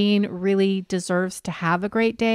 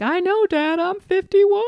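high, louder whiny daughter voice; exasperated daughter; 11 semitones higher, 4 dB louder than usual. Retold or imitated. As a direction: roll your eyes at dad, a bit whiny. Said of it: "she says something like".

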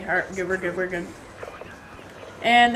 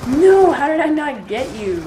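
frustrated shout; 6 semitones higher, 9 dB louder than usual. Real, not acted. Loses a gunfight he expected to win.

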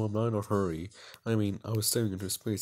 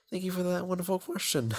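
much higher, sugary pageant answer; pageant contestant; 9 semitones higher than usual. Acted. Contestant answering a judge's question, high and gushing. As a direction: beam at the judges. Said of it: "sounds like an answer from a beauty pageant".

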